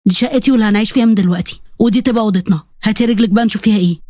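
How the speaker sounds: low-pitched male voice; matter-of-fact husband. Acted. Husband showing his bride their room, firm and low.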